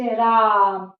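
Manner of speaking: राम with the आ stretched out long and slow; slow teacher demo stretching राम; drawn-out vowel demo. Shown as a demonstration. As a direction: hold the आ in राम to show a pluta vowel. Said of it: "ये प्लुत स्वर हुआ".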